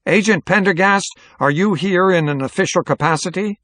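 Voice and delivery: high, projected mayor without a microphone. A narrator's reading. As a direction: call out formally across a hall. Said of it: "raising his voice on account of having lost his amplification".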